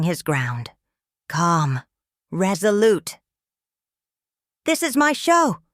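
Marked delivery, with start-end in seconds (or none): 4.6-5.6 high, raised angry cry; screamed outburst. A narrator's reading. Host screaming at guest to claim his show, high angry outburst. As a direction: cry it out as if losing control. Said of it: "Colbert screamed, cracking completely".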